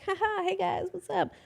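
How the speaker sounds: high, soft fake cheer; put-on happy greeting. Guest showing herself trying to be happy, fake-cheery greeting. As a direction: force out a small, sweet hello. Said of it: "I try to be like".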